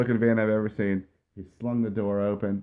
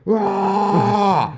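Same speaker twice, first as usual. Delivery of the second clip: loud high imitated scream; long raving madman yell; Alex Jones scream; 10 semitones higher, 6 dB louder than usual. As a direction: scream like an unhinged conspiracy host. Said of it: "he was screaming Alex Jones style".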